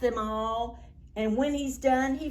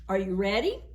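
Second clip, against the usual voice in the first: ordinary delivery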